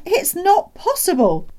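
very high, loud disbelief; astonished cry. A narrator's reading. Doctor reacting to the father's news, astonished cry. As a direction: exclaim as if it can't be true. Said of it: "he cried".

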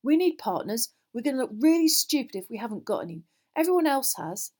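exasperated insistence; impatiently. A narrator's reading. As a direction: press the case impatiently. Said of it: "he said impatiently".

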